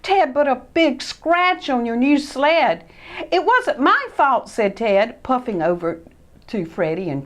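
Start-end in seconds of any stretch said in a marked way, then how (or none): 0.0-2.8 higher, louder tattling call; mild shout. Acted. Sid calling out to tell on Ted. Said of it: "Sid shouted". 3.3-4.5 put-on higher, louder protesting boy; defensive Ted. Ted defending himself to Christopher.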